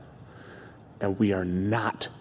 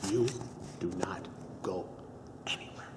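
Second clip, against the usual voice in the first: whispered order; stern whisper; 3 semitones lower, 12 dB quieter than usual. Acted. Telling partner to stay put, whispering. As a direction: hiss the warning.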